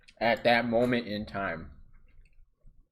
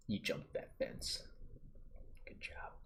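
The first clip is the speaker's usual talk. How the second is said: put-on older sister sneaking praise, whispered; secret whisper; 13 dB quieter than usual. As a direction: slip him the compliment quietly.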